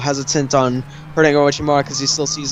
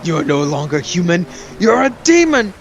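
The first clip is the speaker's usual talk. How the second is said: Sarutobi; 2 semitones higher than usual. Retold or imitated. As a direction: imitate an appalled master denouncing his student. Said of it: "And then he's like".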